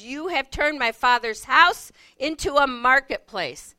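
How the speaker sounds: loud quoted rebuke of the sellers; preacher voicing Jesus' rebuke, loud; retold yell. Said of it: "he yells".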